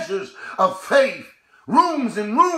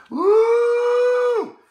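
exultant woo shout; 12 semitones higher than usual. Real, not acted. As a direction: let out a triumphant whoop. Preacher exults after confession.

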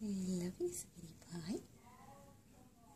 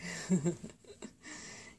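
warm mom laugh; 4 dB louder than usual. Real, not acted. Fond laugh at silly pet.

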